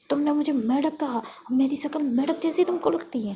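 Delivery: loud, indignant questioning; acted loud huff; offended outrage. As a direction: demand an answer, affronted. Offended at being called frog.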